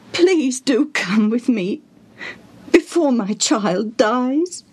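slow, whispered begging; pleading whisper. Acted. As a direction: plead desperately. Father begging Jesus.

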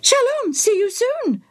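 high parrot squawk copying the priest. Acted. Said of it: "the bird repeated".

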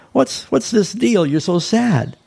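lightly voiced Jesus, slow and playful; Jesus teasing. Jesus teasing the sad disciples on the road, lightly voiced.

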